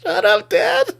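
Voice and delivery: high-pitched mock kid retort; imitating embarrassed kids. Kids answering dad's brag, imitated embarrassment. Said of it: "And they're just like".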